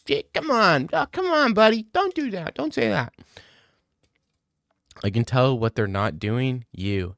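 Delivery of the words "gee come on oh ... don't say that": high, whiny protest to a buddy; pitched-up dismayed appeal; high-pitched pleading. Real, not acted. Mock pleading with a chatter.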